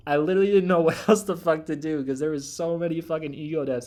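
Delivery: a burst of loud laughter mid-line; laughing. Real, not acted. To viewers about too many ego deaths, laughing loudly.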